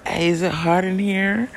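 hint suggestively, drawn out; girl's voice. Acted. Girl hinting in his bedroom.

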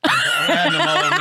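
sudden high squeak; squeaky high. Real, not acted. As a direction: voice jumps way up. Counting Cheetos, voice jumps.

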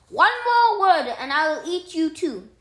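high, very loud menace; threatening shout. A narrator's reading. Cat threatens to eat the mouse, enraged. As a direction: threaten at the top of your voice.

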